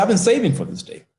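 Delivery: lightly voiced proud father. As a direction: let the father's joy spill out. Father reveals his savings.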